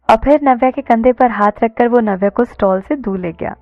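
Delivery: ordinary delivery, nothing marked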